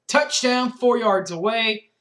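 ordinary delivery

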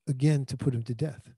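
ordinary delivery

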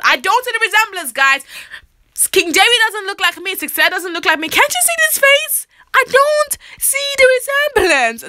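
high, sassy protest; hammed-up disbelief; mock indignant denial. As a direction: playfully insist there's no likeness. Mock denial of sister's resemblance.

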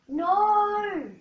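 high, loud yell of No!; frustrated shout. Real, not acted. Gamer yelling at his failed stunt, loud and high. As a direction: cry out as the stunt fails. Crash during game attempt.

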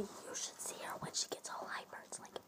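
hushed whispered aside; secretive whisper. Real, not acted. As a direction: whisper it so your friend won't hear. Gossiping behind the friend's back.

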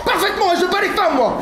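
very high, indignant boast; blustering threat. Acted. Man boasts to lover that he hits, shrieky bluster. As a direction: threaten her at high pitch.

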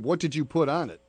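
ordinary talk

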